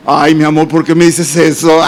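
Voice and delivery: a hint of a startled wife; wife's voice. Wife reacting to hearing she almost became a widow, mildly alarmed.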